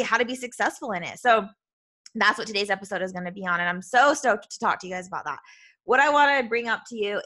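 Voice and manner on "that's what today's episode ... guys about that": ordinary talk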